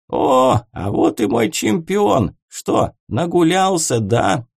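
put-on tongue barely moving, calling out drunk; slurring drunk. A narrator's reading. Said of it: "еле ворочая языком, прокричал старик".

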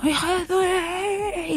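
imitating a rage-filled insult; mock angry insult. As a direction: mimic the furious guy, drawn out. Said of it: "una ira incontrolable".

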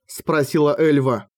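usual speaking voice

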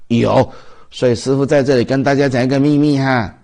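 fervent sermon shout; emphatic preaching. Real, not acted. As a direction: proclaim it like a secret revealed to the hall. Master preaching a secret loudly.

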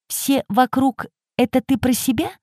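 put-on high, soft old woman; old lady voice. A narrator's reading. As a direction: voice the prickly old lady lightly.

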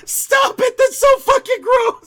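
high, loud shriek of protest; grossed-out shout. Real, not acted. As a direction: yell in disgusted protest. Listener protests the creepy impression.

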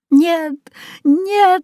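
high-pitched cry of protest; girl's cry. A narrator's reading. Katya protests as the fire is lit, high cry. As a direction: cry out high, each word apart. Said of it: "закричала Катя".

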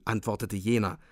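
plain reading voice

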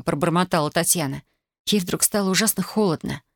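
plain reading voice

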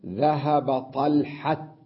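طلحة ending in a clear t, said slowly; slowly, stopping on طلحة with a sounded t; pausal taa. Shown as a demonstration. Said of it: "فيقول". (نطق طلحة بالتاء عند الوقف ببطء)

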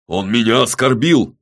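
loud, indignant complaint; loud, affronted military man; soldier's boom. A narrator's reading. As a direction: boom out your offence. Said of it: "пробасил военный".

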